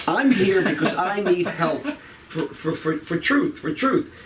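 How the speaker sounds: ordinary delivery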